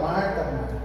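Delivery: low, soft, gentle reproof; Jesus. Acted. Jesus turns to agitated Marta, calm gentle reproof. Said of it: "Jesus vira pra ela e fala".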